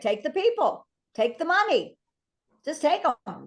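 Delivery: eager agreement. Real, not acted. Coach telling reps to grab both the people and the money, eager. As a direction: snap up the deal happily.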